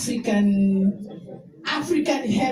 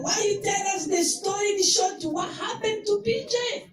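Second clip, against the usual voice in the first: higher, pressing why-questions, mildly exasperated; retold upset question; 8 semitones higher than usual. Friend recalling asking the chair about P J, higher and upset. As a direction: demand the full story, a touch upset. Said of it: "I was upset".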